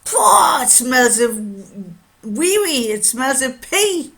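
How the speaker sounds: loud, high exclamation of disgust. Retold or imitated. Customers sniffing a watch that stinks, mock disgust.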